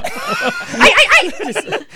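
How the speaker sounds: high squealing ay ay ay; playful Spanish-style ay ay ay; Latin ay ay ay. Pretend. Joking Spanish exclamation.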